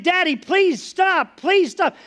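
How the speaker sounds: high, drawn-out begging, retold without sobs; imitated crying plea. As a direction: beg slowly like a hurting child. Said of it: "he's crying".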